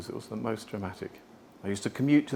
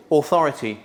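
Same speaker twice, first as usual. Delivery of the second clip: "authority" said much louder and firm; 3 semitones higher, 10 dB louder than usual. Shown as a demonstration. Speaker naming voice tones, "authority" loud and firm. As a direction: bark out "authority" with command. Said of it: "what voice tone can you deliver".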